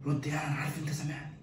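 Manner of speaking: unvoiced whisper; student whispering to classmate. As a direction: whisper it across the exam hall. Calling quietly in exam.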